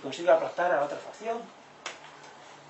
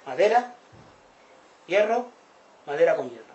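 loud oral men's answer; 2 semitones higher, 9 dB louder than usual. Retold or imitated. Oral villagers naming what they see, loud and eager. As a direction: call out the materials eagerly. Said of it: "Y dicen los orales".

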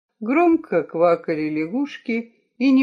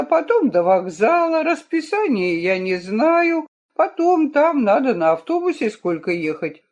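high, complaining grown son; whiny tattling little sibling; 7 semitones higher than usual. Acted. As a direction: protest with whiny pleading. Mitya protests the train.

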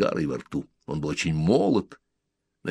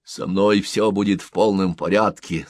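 usual speaking voice

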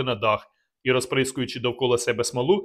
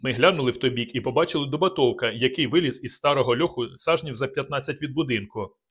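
usual speaking voice

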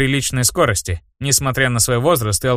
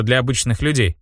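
ordinary delivery, nothing marked

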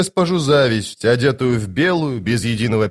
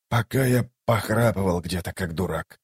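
low, soft grumble; subdued self-reproach; 4 semitones lower, 6 dB quieter than usual. Acted. Toc rueful at having slept, soft and subdued. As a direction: trail down softly, sour with yourself.

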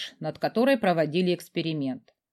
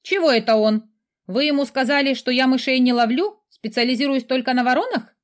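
a light, higher cheeky skull voice; cheeky skull familiar; 5 semitones higher, 4 dB louder than usual. Acted. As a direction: play a sassy talking skull.

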